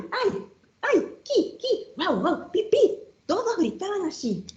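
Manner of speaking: high squeaky yelps, then bark and chirp; animal noises. Acted. Reading the rhyme to listeners, animals squealing up high.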